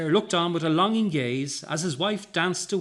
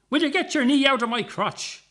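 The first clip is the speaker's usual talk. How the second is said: much higher, loud yelp; crying out; 11 semitones higher, 5 dB louder than usual. A narrator's reading. Guard under the woman crying out. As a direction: yelp it out in pain. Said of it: "the first fallen security man cried".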